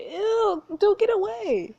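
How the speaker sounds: high-pitched, grossed-out protest; squealed disgust; disgusted recoil. Real, not acted. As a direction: shove him off with your voice, pitch up. Player disgusted as duke moves closer.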